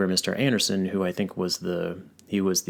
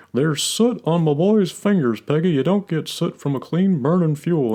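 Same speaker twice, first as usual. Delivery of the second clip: much higher, slow Texan drawl of an outraged dad; Hank Hill voice; 11 semitones higher, 8 dB louder than usual. Retold or imitated. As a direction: lecture Peggy like a proud propane man. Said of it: "it's the way he says things".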